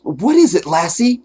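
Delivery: put-on high, eager sitcom-kid voice; imitating Jim Carrey. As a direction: call to the dog like an earnest TV boy. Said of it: "And Jim Carrey go".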